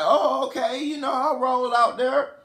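high-pitched girl impression; imitated girl, pitch climbing very high at the end; woman's voice. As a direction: put on an eager high woman's voice. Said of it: "she's like".

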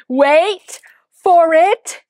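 hammed-up drumroll buildup; loud mock-theatrical countdown; dramatic announcement. Dramatic pause before discarding.

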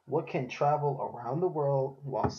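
slow, deliberate reading; reading aloud slowly. Shown as a demonstration. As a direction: read it out slowly, word by word. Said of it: "I'm gonna read it slowly".

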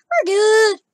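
loud high exclaim; arguing with myself. Real, not acted. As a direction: shout it back at yourself. Reassuring herself, then shouting.

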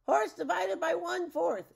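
high, loud spell cast; shouted incantation. A narrator's reading. Witch aims the great divide to cast a spell, loud high incantation.

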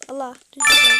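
loud alarmed cry, about to fall; sudden loud panic; panicked shout. Real, not acted. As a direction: yell it as you slip off the edge. Panics about falling in game.

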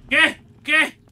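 loud, high double question; alarmed yell at an unseen sound; startled shout. Real, not acted. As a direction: jump at the noise, shouting.

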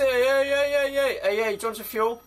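high loud shout at a car; calling out loud. Acted. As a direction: yell to flag the driver down.

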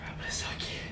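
hushed whisper. Real, not acted. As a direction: breathe it out unvoiced.

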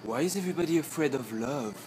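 ordinary delivery

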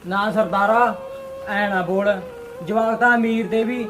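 pleading. Acted. Poor man begs for flour.

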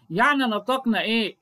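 ordinary talk, nothing marked

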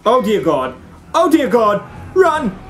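rising from startled to shouted; alarmed panic. Real, not acted. Fleeing after being spotted.

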